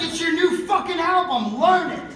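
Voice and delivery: loud, high, laughing bark; mock yell at band. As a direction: boss the band around with a laugh. Yelling at the band.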